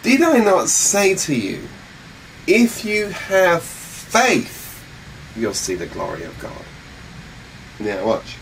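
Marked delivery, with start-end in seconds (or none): 0.0-6.6 higher, drawn-out rebuke; rephrased rebuke. Acted. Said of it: "let me rephrase this for you".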